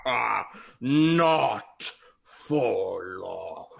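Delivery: slow ghostly cackle then threat, lightly voiced; a hint of gloating ghost; menacing ghost laugh. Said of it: "he kinda laughs".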